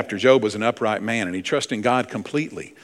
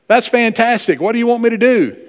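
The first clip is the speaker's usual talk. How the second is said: much higher and louder, delighted relief; relieved and eager; 11 semitones higher, 5 dB louder than usual. Retold or imitated. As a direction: sound thrilled to be saved. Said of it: "joke, man relieved".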